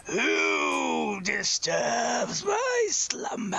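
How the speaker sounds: high, slow booming tomb spirit; dusty mummy rasp. Acted. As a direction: drag it out like a waking mummy. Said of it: "this entity ... appears ... and he says".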